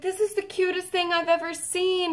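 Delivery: high, gushing imitation; retold delight. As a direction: gush over an adorable gift. Voicing singer's delight at gift.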